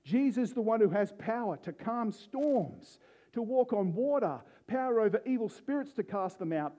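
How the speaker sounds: ordinary talk, nothing marked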